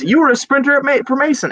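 hammed-up excited read, high and loud; reading out an exclamation. Guest reading his listed skill aloud, hammed-up excitement. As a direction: shout the exclamation mark with mock excitement. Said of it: "Exclamation point".

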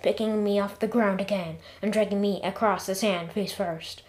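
ordinary delivery, nothing marked

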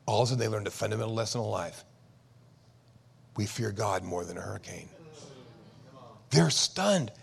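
ordinary talk, nothing marked

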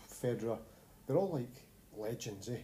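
ordinary talk

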